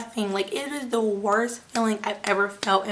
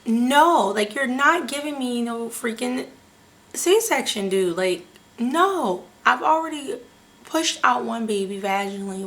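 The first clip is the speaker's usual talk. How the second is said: indignant protest; 4 dB louder than usual. Retold or imitated. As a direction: stretch the words out in exasperated refusal. Outraged protest at being told C-section.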